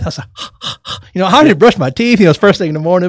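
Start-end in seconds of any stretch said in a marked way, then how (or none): 0.3-1.1 short, voiceless huffs of air; exaggerated breath puffs. Elevator rider showing off morning breath to strangers, mock huffing. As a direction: huff out your morning breath.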